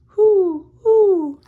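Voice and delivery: high, loud hooting owl. A narrator's reading. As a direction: hoo hoo like an owl. Owl calls in the night.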